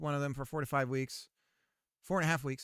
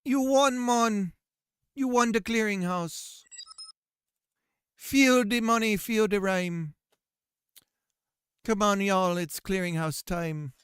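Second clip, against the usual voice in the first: raised, chanting mock Jamaican hype; terrible Jamaican accent; 3 semitones higher, 4 dB louder than usual. Scammer hyping a Clearinghouse win, mock Jamaican accent. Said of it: "my terrible Jamaican accent".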